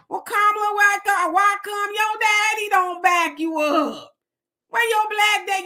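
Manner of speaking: pretend question to Kamala. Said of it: "the sense to say".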